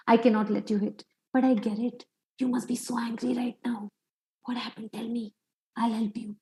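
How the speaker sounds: put-on gently calm an angry child; calm empathic parent. Shown as a demonstration. Parent calmly soothing an angry child after setting a limit, softly.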